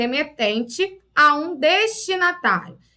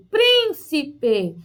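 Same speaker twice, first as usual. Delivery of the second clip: "Príncipe" split up, first syllable strongest; stress on PRÍN. Shown as a demonstration. Teacher repeating for pupils to spot it, "Príncipe" stressed on PRÍN. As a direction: lean on PRÍN, let "ci-pe" fall away. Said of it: "qual a sílaba eu falei mais forte?".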